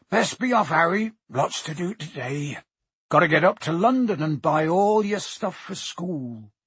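put-on friendly giant rallying the boy; Hagrid, up and busy. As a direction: rouse him in a big rustic voice.

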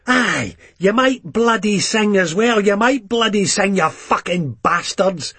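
sneering taunt at his pals; lightly voiced mocking Begbie; Begbie jeering.

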